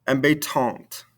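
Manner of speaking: "Embêtante" falling onto an audible final T; sounded ending consonants. Shown as a demonstration. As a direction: release the last T of "Embêtante". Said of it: "makes us pronounce those ending consonants".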